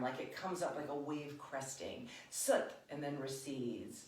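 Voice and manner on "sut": "Sut" pushed out from the diaphragm; breathy emphatic "Sut"; punchy sut. Shown as a demonstration. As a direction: crest the wave on "Sut". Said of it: "visualize a wave of the diaphragm".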